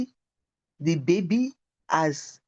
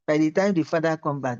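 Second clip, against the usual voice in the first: usual speaking voice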